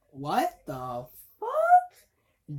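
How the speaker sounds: slow, drawn-out what the fuck; stunned disbelief. Real, not acted. Reviewer reacts to the movie's twist, stunned and drawn out. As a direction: let the shock stretch every word.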